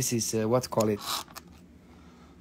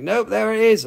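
imitating the lady; 8 semitones higher, 6 dB louder than usual. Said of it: "She's like".

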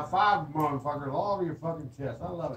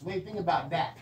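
usual speaking voice

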